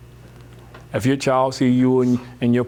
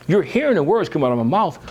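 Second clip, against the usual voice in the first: normal talking voice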